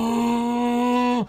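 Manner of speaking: long high hum; imitated drone. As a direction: hold a steady "mm" like a machine droning. Imitates droning noise, movie opening.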